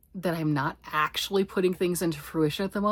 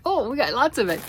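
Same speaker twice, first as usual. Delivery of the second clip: high, loud mock amazement; giddy, raised-voice delight; playful excitement; 7 semitones higher, 7 dB louder than usual. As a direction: exclaim over a big haul, high and loud. Acts excitement over own stash.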